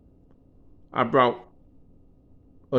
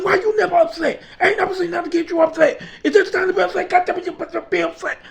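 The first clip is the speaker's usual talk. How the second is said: high, fast, agitated retold rant; worked-up ranting; 11 semitones higher, faster than usual. Boss demanding his worker get upset, agitated. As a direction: act out a boss losing his cool.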